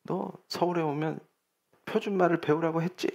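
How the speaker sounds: hushed mock scolding; soft, understated telling-off; scolding mother. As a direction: imitate a mother quietly telling off her child. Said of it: "엄마가 아이한테 막 화를 내는 거예요".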